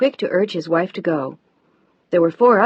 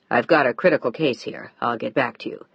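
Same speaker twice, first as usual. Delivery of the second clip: serious; 6 semitones lower than usual. A narrator's reading. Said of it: "he sounded desperately serious again".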